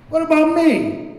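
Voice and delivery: high, squeaky put-on whine; mock whiny complaint. Recovering addict mocking his old self, high whine. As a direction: mimic your younger self whining.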